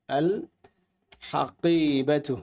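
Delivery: slow, syllable by syllable: "আল … হাকিবাতু" with a clear i after the k; a teacher drawing out "হাকিবাতু" for students; careful demo. Shown as a demonstration. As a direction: spell out "হাকিবাতু" slowly, a pause after "আল". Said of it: "এখানে কাফের নিচে জের হবে".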